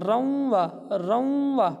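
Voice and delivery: روں held through the nose before وا; nasal ghunna. Shown as a demonstration. Teacher drilling ghunna for students, nasal روں. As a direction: push the نون sound of روں into the nose. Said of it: "گنہ کریں گے".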